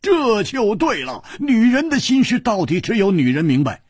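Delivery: triumphant booming hero; loud exclaiming. Acted. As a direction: slap the table and announce it to everyone. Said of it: "大声说".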